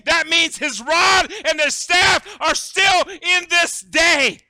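loud, high proclamation climbing to a shout; impassioned preacher at full volume; full-force preaching. Real, not acted. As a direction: preach it with surging force and stretched key words.

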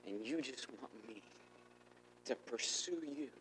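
soft, humbled prayer; hushed realization. Retold or imitated. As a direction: say it gently to the Lord. Acts out quiet realization to God.

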